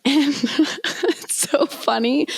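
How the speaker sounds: high, laughing through the words; real amusement. Real, not acted. Friend recalling the airport dash, laughing. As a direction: giggle while starting the funny part.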